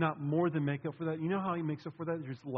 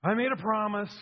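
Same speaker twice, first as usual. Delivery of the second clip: loud, pleading, high pleading to Father; hammed-up reluctant servant praying loudly; mock dutiful prayer; 5 semitones higher, 7 dB louder, slower than usual. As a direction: whine out a reluctant duty-bound vow. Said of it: "He didn't say".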